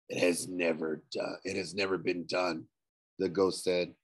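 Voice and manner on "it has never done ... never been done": low, soft ghostly voice. A narrator's reading. Ghost tells Nico it was never done, low and hollow. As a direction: speak like a hollow shade.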